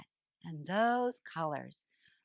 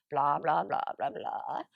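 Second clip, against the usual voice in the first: dismissive blah; 6 semitones lower than usual. Acted. Brushing off unanswered messages.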